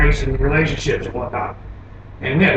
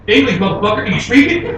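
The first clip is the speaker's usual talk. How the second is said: high, rising mock outburst; Pulp Fiction anger; 6 semitones higher, 3 dB louder than usual. Mad friend snaps at the motel clerk, high mock outburst. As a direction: act out a fed-up movie-style demand. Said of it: "he gets all Pulp Fiction on it".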